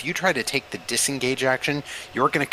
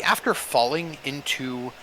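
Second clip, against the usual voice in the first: ordinary talk, nothing marked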